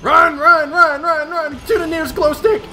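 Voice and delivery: high, loud panicked yelling; being chased. Real, not acted. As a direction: shout while running for your life. Fleeing monster to glow stick.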